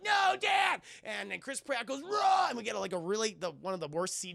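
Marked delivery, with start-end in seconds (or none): 0.0-0.8 high, loud despairing yell; loud high mock-anguished cry; anguished shout. Dad about to be eaten. 2.0-2.5 high, loud urgent yell; loud high command to flee; urgent shout. Retold or imitated. Warning dad to flee.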